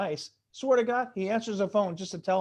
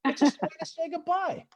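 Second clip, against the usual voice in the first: high squeaky voice; 12 semitones higher than usual. Acted. Comic high-pitched punchline.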